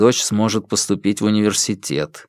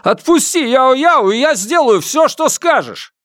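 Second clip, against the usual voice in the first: desperate begging shout; 3 dB louder than usual. Acted. Father begs for his daughter.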